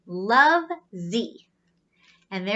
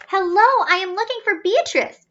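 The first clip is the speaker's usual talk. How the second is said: eager robot greeting; 8 semitones higher, 4 dB louder than usual. Acted. Z exclaims.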